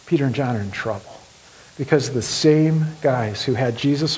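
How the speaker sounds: soft, grave sermon narration; quiet, heavy storytelling with long pauses; hushed solemn storytelling. Acted. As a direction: draw the room in with a hush.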